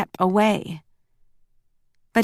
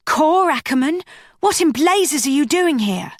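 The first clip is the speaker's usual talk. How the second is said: high, sharp startled demand; startled outburst; 7 semitones higher than usual. Acted. Willa, alarmed, confronting the man who followed her, in a high startled demand. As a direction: caught off guard, she fires it at him.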